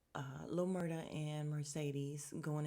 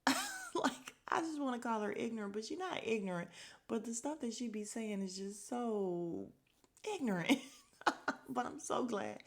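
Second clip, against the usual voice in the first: laughing; 6 semitones higher, 3 dB louder than usual. Real, not acted. Laughing rant.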